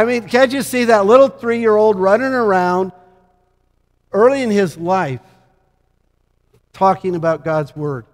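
ordinary delivery

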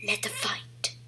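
hoarse villain whisper; sinister whispered villain voice. Acted. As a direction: breathe it like a dark lord. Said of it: "speaking in this voice now".

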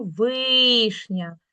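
"вишня" with a long drawn-out И; stress on И. Shown as a demonstration. Teacher giving a check word, stressed И. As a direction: stretch the first syllable of "вишня". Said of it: "у нас не наголошений".

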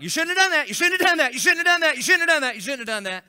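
high, loud repeated scolding; dog scolding from across the creek, loud and high; scolding bark. Retold or imitated. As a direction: bark it at her again and again. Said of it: "I think it's kinda like".

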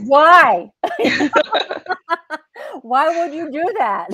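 loud, high, laughing through the question; baffled and cracking up; laughing disbelief. Real, not acted. Asking why anyone would keep a head, laughing in disbelief.

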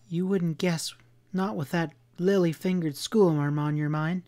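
high-pitched woman's bitter retort. A narrator's reading. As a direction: throw the jibe back with some bitterness. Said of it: "she replied bitterly".

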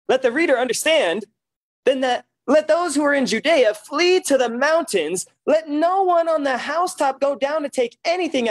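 rising preaching intensity. Real, not acted. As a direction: build the reading into preaching. Preacher reading builds in force.

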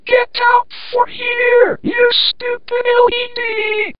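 very high, slow, drawn-out yell; angry outburst. Acted. Old lamp throwing out the LED, high angry yell. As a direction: drive the intruder out in a high-pitched cry.

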